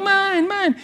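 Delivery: put-on very high, whiny child claim; kids squabbling. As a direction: squabble like a little boy fighting over a toy. Two kids claiming the watch.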